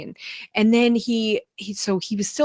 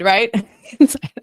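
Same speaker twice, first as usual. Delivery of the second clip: laughing; 2 semitones higher, 8 dB louder than usual. Real, not acted.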